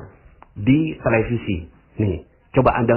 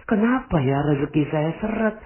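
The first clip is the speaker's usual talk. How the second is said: mengeluh ke Allah; 8 semitones higher than usual. Retold or imitated. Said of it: "Anda sekarang ini berintrospeksi".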